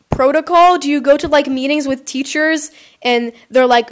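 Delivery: normal talking voice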